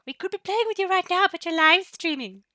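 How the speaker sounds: high, loud teasing whine; hammed-up whiny friends; mock complaining fans. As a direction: voice the viewers' pouty complaint. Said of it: "I know you're all like".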